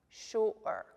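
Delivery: "sure" split as shu-er by a throat catch; glottal stop. Shown as a demonstration. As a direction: break "sure" in two, shu'-er, drawn out. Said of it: "make that little stop in your throat".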